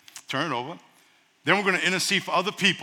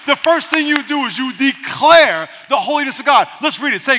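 usual speaking voice

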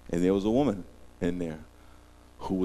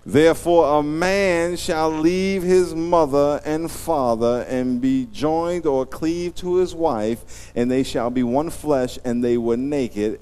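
scripture reading; 6 semitones higher, 5 dB louder, slower than usual. Acted. Reads scripture.

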